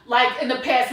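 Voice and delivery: ordinary delivery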